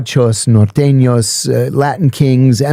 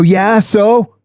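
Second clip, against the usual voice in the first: retold high-pitched, challenging reply; defiant comeback; 8 semitones higher than usual. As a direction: answer back as if unimpressed. Defiant answer to intimidating inmate.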